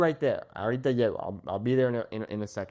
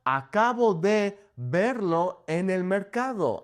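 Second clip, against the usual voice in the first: slow clear demo; 4 semitones higher, slower than usual. Shown as a demonstration. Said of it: "replaced with something like "acabo de".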